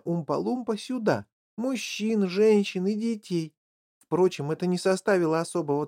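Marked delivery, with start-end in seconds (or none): none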